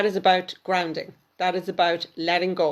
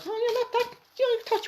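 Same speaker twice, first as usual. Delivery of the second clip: sudden high-pitched disbelief; high surprised squeal. Real, not acted. Psychic hears caller is forty-four, squeals in surprise. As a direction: squeak in astonishment at her age.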